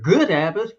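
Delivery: "good" stressed and lifted above "habit"; emphasis on "good". Real, not acted. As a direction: punch "good", then let "habit" follow. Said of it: "I emphasize that word".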